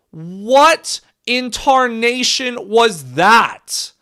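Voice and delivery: slow, loud, high drawn-out outrage; stunned disbelief. Real, not acted. Fan reacting to the opponent scoring, loud stunned drawl. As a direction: stretch every word in shock. Stunned after rival touchdown.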